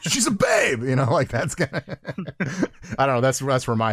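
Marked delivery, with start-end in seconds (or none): 0.0-0.8 mildly raised, higher "She's a babe!"; playful high exclamation; Wayne's World shout. Retold or imitated. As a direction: yell it like a Wayne's World fanboy. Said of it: "almost like Wayne's World".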